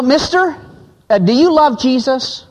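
put-on little girl asking. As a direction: ask it like a nosy ten-year-old. Young girl quizzing a businessman.

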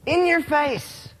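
high, slow basketball taunt. Shown as a demonstration. Preacher repeats the basketball taunt for listeners, high and taunting. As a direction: draw out each word and crow it. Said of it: "It's a basketball taunt".

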